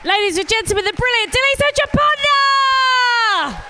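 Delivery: rousing, high shouted introduction; MC announcement. Real, not acted. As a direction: build to a long, shouted name. MC announces act to cheering crowd.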